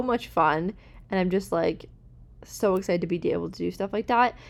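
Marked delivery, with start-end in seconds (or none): none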